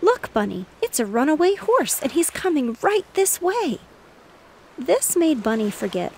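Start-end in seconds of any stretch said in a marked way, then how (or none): 0.0-3.8 higher, louder and rising alarm; excited loud warning; much excited. Acted. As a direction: warn him urgently as the horse nears. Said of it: "who was much excited".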